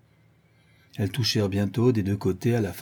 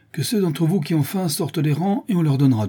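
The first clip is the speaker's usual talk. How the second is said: higher, projected announcement; addressing a crowd; 7 semitones higher than usual. A narrator's reading. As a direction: call out an offer to the crowd. Il harangue la foule. (harangue à voix haute)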